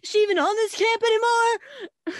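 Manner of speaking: fast, rising to a high loud wail; hammed-up lovesick boy whine; mock whiny Shane. Retelling the movie, Shane pining for the mystery girl, mock whine. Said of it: "And Shane's like".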